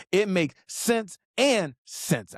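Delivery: slow and deliberate, each word spaced. Shown as a demonstration. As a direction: spell it out slowly for the viewer. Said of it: "Let me say that again a little slower".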